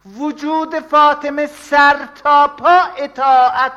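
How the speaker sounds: slow, high and loud proclamation; drawn-out rousing sermon cry; fervent climax. Acted. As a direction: cry it out to the crowd. Preacher climaxes on Fatima.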